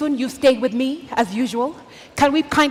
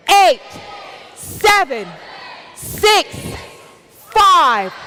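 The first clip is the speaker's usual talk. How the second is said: countdown hype; 3 semitones higher, much slower than usual. Acted. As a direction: pump the crowd up number by number. Host leads a countdown.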